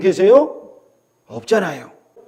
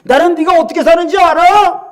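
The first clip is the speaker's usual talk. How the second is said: taunting devil; 6 semitones higher than usual. Acted. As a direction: needle someone, building to a high accusation. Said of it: "마귀가 우리 속에서". (점점 높아지며 몰아세우는 마귀 목소리)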